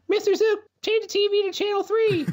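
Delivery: put-on very high squeaky kid voice; schoolkid. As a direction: falsetto pupil calling out to the teacher. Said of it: "Can one of you kids".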